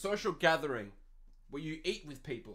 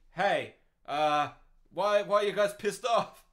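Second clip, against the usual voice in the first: louder, puzzled mock official; hammed-up baffled government voice; mock clueless official; 2 semitones higher, 8 dB louder than usual. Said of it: "we think".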